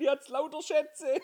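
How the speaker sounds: cheeky shout. Retold or imitated. Kumpel ruft zurück.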